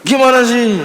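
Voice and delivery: high, exasperated snap; annoyed scolding. Acted. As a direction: throw up your hands at the pupil. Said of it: "Ah, marah gitu".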